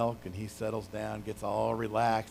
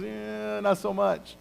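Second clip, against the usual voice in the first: reluctant shrug; 8 semitones higher, 5 dB louder, slower than usual. Retold or imitated. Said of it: "he was".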